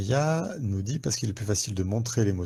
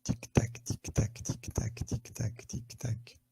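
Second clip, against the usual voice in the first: whispered tick-tock, each one softer until barely there; whispered countdown fading; 8 dB quieter, slower than usual. Acted. Host counting down viewers' twenty seconds, whisper fading away.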